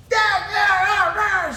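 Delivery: high, loud hammed-up groaning; mock frustrated yelling; mock angry groan. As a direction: howl it like a grumpy wake-up. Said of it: "her angry morning outburst".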